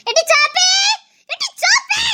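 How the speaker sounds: high loud call of the name. Acted. Old woman calling Soppi out of the house. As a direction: call her name sharply.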